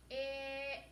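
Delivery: "P, ick" split into two sounds, held long. Shown as a demonstration. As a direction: tap out "P" then a stretched "ick". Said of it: "One more time".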